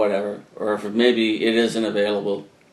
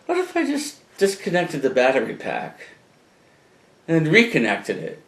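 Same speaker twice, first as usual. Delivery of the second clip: high, wide-eyed innocent voice; mock innocent voice; 7 semitones higher than usual. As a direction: play dumb, sweetly naive. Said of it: "I said".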